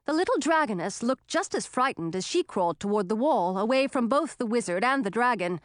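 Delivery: ordinary delivery, nothing marked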